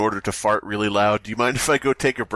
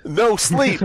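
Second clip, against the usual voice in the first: slam poetry; 7 semitones higher than usual. Pretend. Echoing in slam poem voice.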